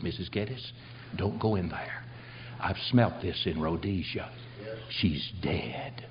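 low, soft and grave, sinking to a near whisper; hushed warning. Retold or imitated. Preacher retells warning his companion at the back door, hushed warning. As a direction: drop right down to a hush. Warns companion at death scene.

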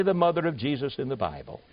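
ordinary delivery

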